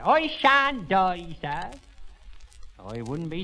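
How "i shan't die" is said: slow, very high, loud shout. Shown as a demonstration. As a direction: shout it back at full volume. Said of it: "shouted it at me like that".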